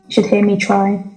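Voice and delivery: ordinary delivery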